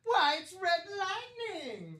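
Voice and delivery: high, drawn-out mock play-by-play call; hammed-up excited cry. Co-host hyping Julio Jones's nickname, hammed-up excited cry.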